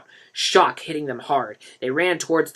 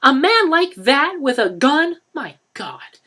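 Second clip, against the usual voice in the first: higher, slow appalled woman; horrified disbelief; 13 semitones higher, 9 dB louder, slower than usual. A narrator's reading. Mother appalled at the gun.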